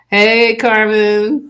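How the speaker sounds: high, loud, drawn-out greeting; excited shout-out. Real, not acted. Host hailing a viewer who just joined, excited shout-out. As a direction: call out happily, stretching the words.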